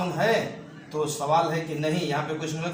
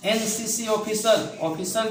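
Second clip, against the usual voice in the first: slow careful reading; 4 semitones higher, slower than usual. Shown as a demonstration. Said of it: "teacher reads next example".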